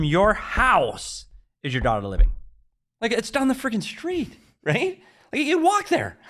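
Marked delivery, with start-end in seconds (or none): 3.0-6.2 incredulous. Real, not acted. Mocking Craig's long goodbye.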